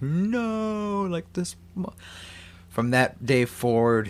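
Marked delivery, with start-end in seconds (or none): 0.0-1.9 high squeaky mock no; Rumpelstiltskin's cry. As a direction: yelp it like a cheated little imp. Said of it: "Rumpelstiltskin is just like".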